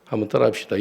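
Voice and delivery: normal talking voice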